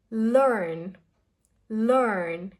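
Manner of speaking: "Learn" with a heavy R-coloured vowel; "learn" drawn out, R strongly sounded; strong American R. Shown as a demonstration. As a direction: curl the tongue up through "learn". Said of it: "it's a strong ra sound".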